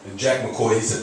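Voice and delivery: usual speaking voice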